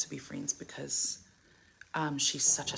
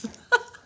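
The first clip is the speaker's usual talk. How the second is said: burst of laughter; 7 dB louder than usual. Real, not acted. Laughs mid story.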